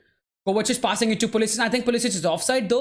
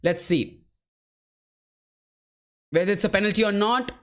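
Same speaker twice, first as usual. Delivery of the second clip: normal talking voice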